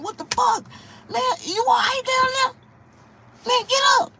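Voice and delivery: high, loud panicked yelling; panicked shout. Retold or imitated. Comedian shouting at his own body to get up, high and panicked.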